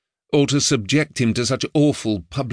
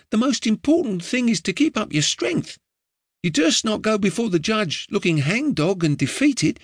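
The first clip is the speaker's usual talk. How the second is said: put-on higher boyish voice, earnest and chatty; a street boy urging his doomed master, higher; eager boy Ned; 8 semitones higher than usual. A narrator's reading. Street boy giving his master earnest advice, higher boyish voice.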